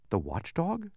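quizzical. A narrator's reading. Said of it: "said Milo quizzically".

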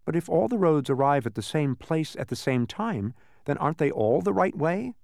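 mildly puzzled, rising question; questioning Milo. A narrator's reading.

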